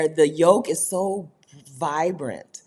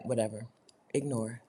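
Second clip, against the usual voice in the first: bored; 6 semitones lower, 9 dB quieter than usual. Retold or imitated. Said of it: "Now y'all are like".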